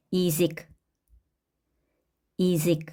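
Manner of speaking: 이직 with initial ya said as i; unstressed ya as i. Shown as a demonstration. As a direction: say 이직, stress on the second vowel. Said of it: "야는 이로 발음을 한다".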